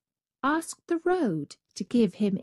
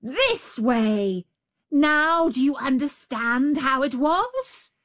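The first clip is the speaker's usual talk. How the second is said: louder triumphant shout; cried; 6 semitones higher, 4 dB louder, slower than usual. Acted. The tiger jumps into the cage, louder. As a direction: bellow it leaping in.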